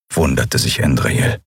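usual speaking voice

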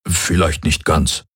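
slow, sheepish admission; slightly embarrassed. A narrator's reading. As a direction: admit it haltingly, a bit awkward. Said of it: "sagte Hox etwas verlegen".

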